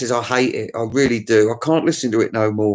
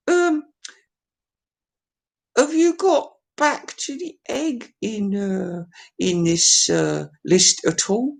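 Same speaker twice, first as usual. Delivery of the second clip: much higher, small cartoon voice; squeaky sidekick voice; 8 semitones higher, slower than usual. Socrates interrupting the host, much higher squeaky voice. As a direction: pipe up like a tiny puppet. Socrates asks about the album.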